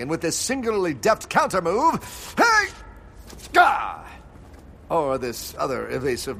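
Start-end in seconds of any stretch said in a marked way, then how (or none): 2.4-4.0 startled yelp. Acted. Hero grabbed mid-move.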